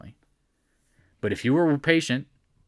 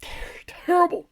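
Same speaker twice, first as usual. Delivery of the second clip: high mock whisper of dismay. Scoffing at a dollar gain, whispered mock horror.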